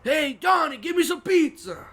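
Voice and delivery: high, loud mock mobster yell; mobster shout. Kid playing mob boss yells for pizza. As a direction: bark the order like a cartoon mob boss.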